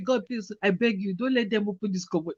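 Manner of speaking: soft, quiet desperate prayer; hushed prayer. Retold or imitated. Hiding in the cupboard, praying very softly. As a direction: pray under your breath. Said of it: "inside the cupboard praying".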